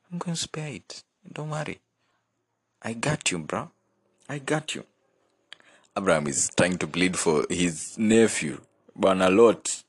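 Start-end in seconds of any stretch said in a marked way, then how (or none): 0.1-4.8 reassuring God. Retold or imitated. God voiced, reassuring Abraham.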